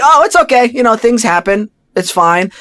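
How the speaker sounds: bright, high and loud fake-okay voice; brushing it off too brightly; forced cheerfulness. Actor told test is tomorrow, forced cheerful reply. Covering disappointment to producers.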